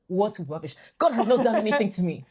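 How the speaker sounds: a touch of indignant protest; indignant retort. Retold or imitated. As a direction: push back, offended at the idea. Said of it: "I said".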